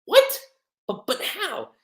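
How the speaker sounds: stunned, caught off guard; shocked gasp. Retold or imitated. Wendy shocked seeing Irene.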